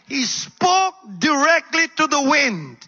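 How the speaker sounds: ordinary delivery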